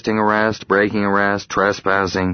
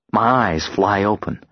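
ordinary delivery, nothing marked

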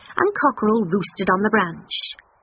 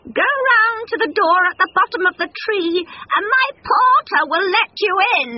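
loud, high sly invitation; 12 semitones higher, 4 dB louder than usual. Acted. Cockerel slyly inviting the fox up. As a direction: lure him in with fake welcome.

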